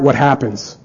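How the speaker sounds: ordinary talk, nothing marked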